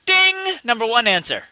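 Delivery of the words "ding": high, loud bell ding; ding sound. Pretend. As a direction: ring it out like a bell. Game show bell sound.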